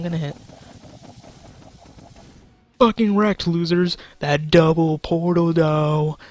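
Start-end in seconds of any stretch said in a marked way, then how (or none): 2.8-6.2 loud, excited trash talk; loud gloating. Real, not acted. Gamer gloats to beaten opponents over a double portal shot, loud gloating. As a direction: crow loudly over the win.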